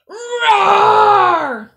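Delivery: high, full-voiced roar; loud roar. A narrator's reading. Lion pupil roars for the teacher, loud high roar. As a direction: roar as loud as you can. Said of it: "roared as loud as I could".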